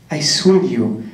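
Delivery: usual speaking voice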